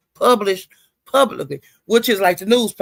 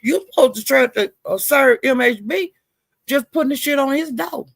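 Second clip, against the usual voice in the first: retold heated callout; 6 semitones higher than usual. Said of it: "And I was saying".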